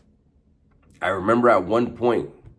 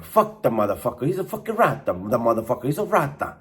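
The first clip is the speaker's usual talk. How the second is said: angry mock Italian rant, rising much higher on rata; Italian accent; 5 semitones higher than usual. [name] cursing a snitch on the track in a high mock Italian accent. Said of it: "hearing him talk like that".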